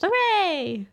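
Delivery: high guinea pig cheer; high-pitched little hooray. A narrator's reading. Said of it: "one of the guinea pigs cheered".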